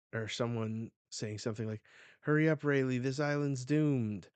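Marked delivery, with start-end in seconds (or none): 2.2-4.3 loud, raised warning; acted urgent shout. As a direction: shout to a crewmate to hurry, play-acted.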